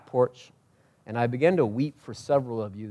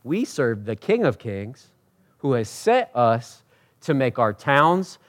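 usual speaking voice

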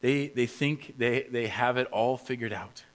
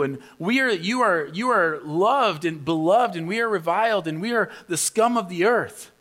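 high, loud mock-admiring rant; sarcastic rant; 7 semitones higher, 5 dB louder than usual. Paul mocking the self-satisfied church's boasts, sarcastic rant. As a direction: pile on the irony, praising them and belittling yourself. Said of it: "goes on a sarcastic rant".

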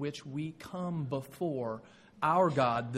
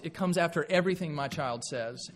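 usual speaking voice

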